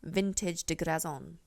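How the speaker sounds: put-on slow, playful French accent on the name; a hammed-up French accent for a fancy lady's name; mock French accent. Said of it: "I don't know why I said that French".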